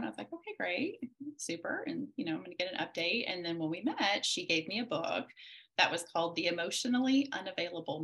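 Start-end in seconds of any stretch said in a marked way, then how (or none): 0.3-1.8 high, bright put-on cheer; chirpy, clueless agreement at counselor's request; mock chirpy okay. Mom in a high, mock-cheery voice. Retelling own reaction to counselor.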